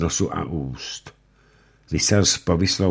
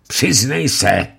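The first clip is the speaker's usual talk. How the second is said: high, loud, slow command; stern judge; 8 semitones higher, 6 dB louder, slower than usual. Acted. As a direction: demand a confession. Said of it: "řekl rychtář".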